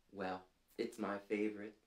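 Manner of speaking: plain reading voice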